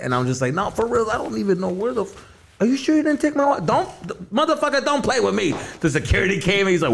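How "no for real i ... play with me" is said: high-pitched, worked-up retold accusation; agitated accusing. Drunk customer accusing dancer over wallet, agitated. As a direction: confront them, voice climbing high.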